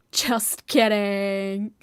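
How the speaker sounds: slow, loud teasing drawl. Real, not acted. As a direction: stretch it out to tease the chat. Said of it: "I'm evil".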